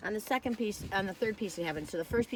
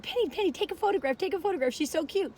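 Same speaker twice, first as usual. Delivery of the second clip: high, eager calling out; excited calling; 10 semitones higher than usual. Retold or imitated. Calling Penny over to photograph the dog, excited and high. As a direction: urgently call your friend over.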